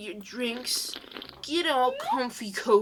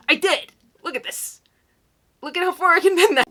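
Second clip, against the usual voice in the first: loud high burst of glee; excited; 8 semitones higher, 12 dB louder than usual. Real, not acted. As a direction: shout it out, thrilled. Excited bragging about flexibility.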